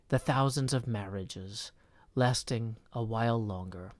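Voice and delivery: soft, measured poetry reading; poem reading. Acted. Reading the poem aloud, slow and soft.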